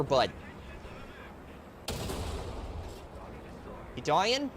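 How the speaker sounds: hammed-up Canadian accent, sing-song buddy taunts; Canadian war crimes voice. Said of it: "I broke out the Canadian war crimes voice".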